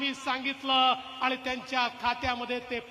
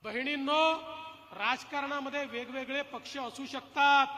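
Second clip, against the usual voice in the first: usual speaking voice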